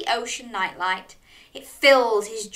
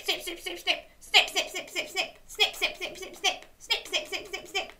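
high, soft staccato snips; crab claws snipping; 9 semitones higher, 6 dB quieter than usual. Pretend. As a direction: snip rhythmically like little pincers. Imitating crab claws with children.

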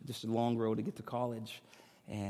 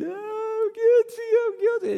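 much higher, slow wailing imitation of his wife; crying confession; 10 dB louder than usual. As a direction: wail it like a sobbing wife caught speeding. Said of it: "she's crying".

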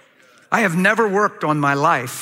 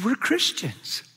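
soft, slow, higher imitation of her; imitated solemn reminder, drawn out; so serious; 6 semitones higher, 6 dB quieter, much slower than usual. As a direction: say it hushed and earnest. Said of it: "She looked at me so serious".

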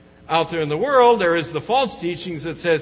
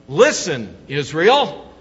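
loud, commanding call to attention; forceful emphatic summons, clearly louder; 7 dB louder, slower than usual. Acted. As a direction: punch it out like an exclamation point. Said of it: "an emphatic kind of tone of voice".

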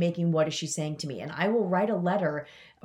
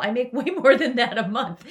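laughing through words; 5 semitones higher, 7 dB louder than usual. Real, not acted. Laughing at her own earnings.

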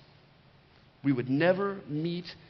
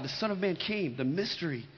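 ordinary talk

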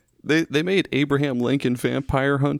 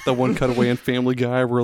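ordinary talk

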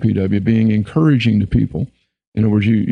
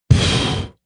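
scornful unvoiced pfft through the lips; eye-rolling pfft of disgust. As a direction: puff air out dismissively through the lips. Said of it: "with your lips".

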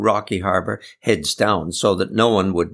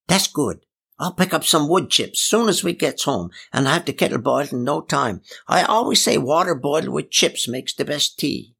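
lightly voiced old lady, higher pitch; a hint of a chattering country aunt, pitched up; Aunt Polly; 5 semitones higher than usual. Old lady promising tea at home, lightly voiced, higher.

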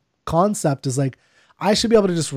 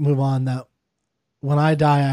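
normal talking voice

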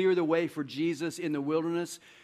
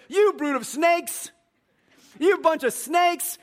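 slow, much louder and higher shout of the insult; mock prophet shout; 13 semitones higher, 9 dB louder, slower than usual. Preacher acting John opening his sermon, mock prophet shout. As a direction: roar it like a fiery prophet.

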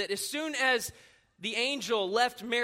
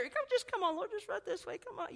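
hushed coaxing; 8 semitones higher, 7 dB quieter than usual. Acted. Acted ruler hurrying Jesus along.

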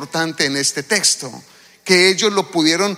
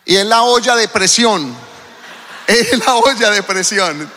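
laughing. Real, not acted. Laughing at the pun.

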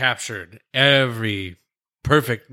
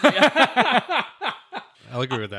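slow, high, laughing agreement; 10 semitones higher, much slower than usual. Real, not acted. Laughing agreement over favorite movie.

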